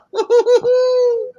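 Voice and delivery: loud squealing laugh; high shrieking laugh. Real, not acted. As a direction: burst into a shrill laugh. Laughing at the answer.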